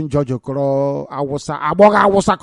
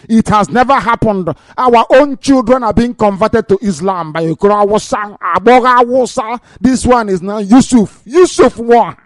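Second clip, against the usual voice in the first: high, loud denunciation; real outrage; 5 semitones higher, 4 dB louder than usual. Real, not acted. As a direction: sound the alarm, voice raised on every name. Outraged over conversions, after declared rage.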